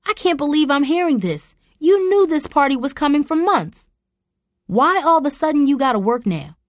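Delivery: high, loud outraged scolding; angry scolding. Acted. Mom scolding daughter Tara over skipping the reunion, outraged and loud.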